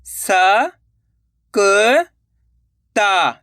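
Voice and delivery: स, क, ता one at a time with pauses; sounding out letters. Shown as a demonstration. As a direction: separate स, hold, क, hold, then ता. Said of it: "तो मिलके बन जाएगा".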